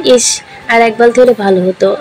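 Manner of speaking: ordinary delivery, nothing marked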